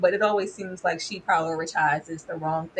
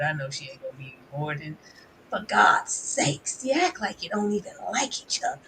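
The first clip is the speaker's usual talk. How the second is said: imitating Mama Evelyn; 4 semitones lower, 5 dB quieter than usual. Recapper doing a quiet weary impression of Mama Evelyn. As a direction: drag it out like a weary mother. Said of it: "one more from Mama Evelyn".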